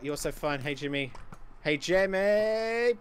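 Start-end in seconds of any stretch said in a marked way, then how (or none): 1.6-2.9 high call, last word stretched long; teasing call. Real, not acted. Gamer pestering rival Jimmy, high drawn-out call. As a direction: draw the name out, pitched up.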